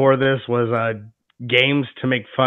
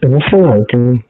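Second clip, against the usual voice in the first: drive-thru speaker; 6 dB louder than usual. Real, not acted. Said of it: "like a drive-thru speaker".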